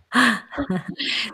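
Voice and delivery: bright high burst of laughter. Real, not acted. Host laughing at her guides' saying, high laugh. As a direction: laugh at your own joke.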